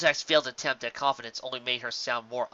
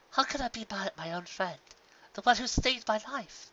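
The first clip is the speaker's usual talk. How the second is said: tender; 8 semitones higher, 4 dB quieter than usual. Acted. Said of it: "soft, yet firm hug".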